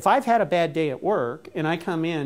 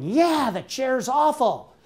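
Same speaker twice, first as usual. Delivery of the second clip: higher, slow hammed-up supportive wife; 7 semitones higher than usual. Wife backing her husband against the chair, hammed-up support. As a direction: egg him on like a loyal wife.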